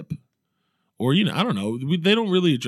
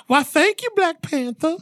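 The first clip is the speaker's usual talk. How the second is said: much higher, giggly swoon; swooning woman. Acted. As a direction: answer like a flattered lady. Swooning woman replying to hero.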